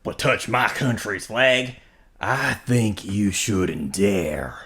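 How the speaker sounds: slow-burning Texan warning; Texan accent. Pretend. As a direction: warn them in a hammed-up Texan accent. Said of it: "do your best Texan accent".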